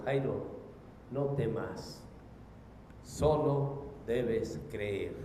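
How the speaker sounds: low, slow, tender words of comfort; deep calm, unhurried and soft; calm reassurance. Acted. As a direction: reassure a frightened father slowly and gently. Voices Jesus reassuring Jairo.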